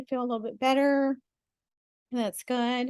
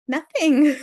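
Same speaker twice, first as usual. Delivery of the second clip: laughing; 6 semitones higher, 6 dB louder than usual. Real, not acted. Laughs after reassurance.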